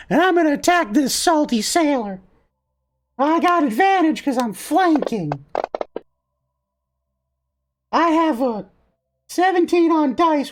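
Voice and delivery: high-pitched little cookie voice; feisty gingerbread boy. Acted. Gingerbread boy announcing his attack on the salty sailor, feisty. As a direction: squeak it as a plucky gingerbread fighter.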